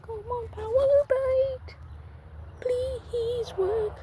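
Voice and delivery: high, slow coaxing plea; high pleading voice. Real, not acted. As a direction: beg the fish sweetly to bite. Pleading with fish to bite.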